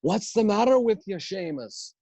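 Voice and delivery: high-pitched mock Irish accent. Improv partners badgering the mute character, high mock Irish accent. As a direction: pester in a hammed-up Irish lilt. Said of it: "hear themselves do the Irish accent".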